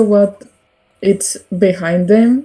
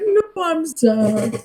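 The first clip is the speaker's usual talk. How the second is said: slow, soft, higher mopey no; mock sad; 8 semitones higher, 5 dB quieter, much slower than usual. Shown as a demonstration. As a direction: whimper it like a sulky kid. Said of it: "as in, like".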